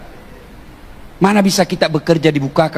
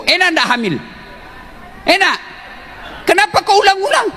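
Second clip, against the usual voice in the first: preaching at full force; 11 semitones higher than usual. Acted. Ustadz fires up the congregation about wives and husbands, at full force. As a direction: preach it hard to the crowd, rising. Preacher rises to fervent pitch.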